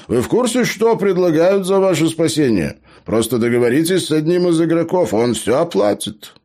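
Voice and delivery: high-pitched, animated gnome pitch; eager gnome, persuading. Acted. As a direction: press the offer with high, lively urgency. Gnome urges Joe to accept offer.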